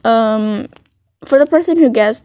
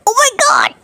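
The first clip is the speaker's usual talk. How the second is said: high mock inner scream; screaming mess; 12 semitones higher than usual. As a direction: squeal it in a much higher pitch. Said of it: "he's, like, a screaming mess".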